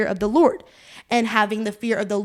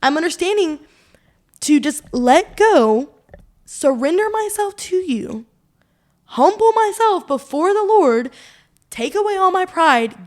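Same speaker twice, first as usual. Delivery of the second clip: heartfelt vow; 6 semitones higher than usual. Real, not acted. Heartfelt declaration of surrender.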